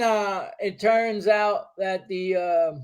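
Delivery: ordinary talk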